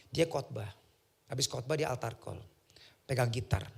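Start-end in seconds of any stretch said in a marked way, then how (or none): none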